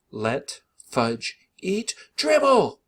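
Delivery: screaming. A narrator's reading. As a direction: scream it in outrage at mom. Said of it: "I screamed".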